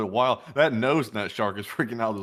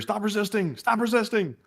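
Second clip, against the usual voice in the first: high mock cop order; hammed-up stop resisting; mock cop command; 11 semitones higher than usual. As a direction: bark it like a body cam cop. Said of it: "The obligatory".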